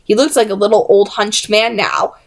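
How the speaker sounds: ordinary delivery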